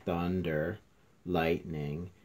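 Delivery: ordinary delivery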